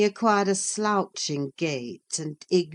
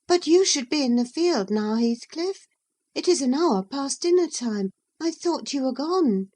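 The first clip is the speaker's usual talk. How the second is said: put-on high, lightly scolding girl's voice; Catherine's voice; 9 semitones higher, 3 dB louder than usual. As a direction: nudge him back to work in a high voice. Catherine chides Heathcliff.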